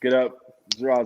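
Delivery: ordinary delivery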